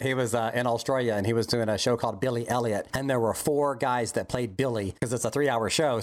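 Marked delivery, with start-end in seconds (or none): none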